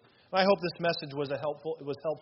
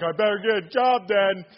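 laughing reply; 4 semitones higher, 8 dB louder than usual. Retold or imitated. Groom answers wife, half laughing.

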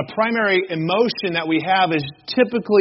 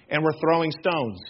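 ordinary delivery

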